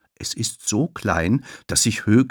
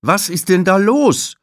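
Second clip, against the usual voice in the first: strict receptionist; 12 semitones higher, 8 dB louder than usual. Acted. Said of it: "fragt Tulile".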